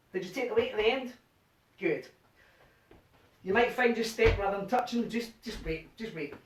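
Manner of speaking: ordinary talk, nothing marked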